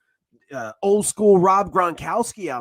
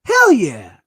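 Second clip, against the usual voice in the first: loud, high fan cheer; excited cheer; 7 semitones higher, 7 dB louder, much slower than usual. Retold or imitated. Fan reacting to the new coach, excited cheer. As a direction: cheer like the coach just won you over. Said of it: "And I'm like".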